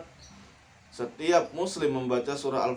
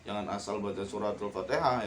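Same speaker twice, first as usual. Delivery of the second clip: normal talking voice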